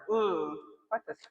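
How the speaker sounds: slow, soft and fed up, in an odd voice; fed-up mutter. Retold or imitated. To the viewers, about a cheating guy, slow fed-up mutter. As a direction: let it drag out, done with it all. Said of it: "I'm like".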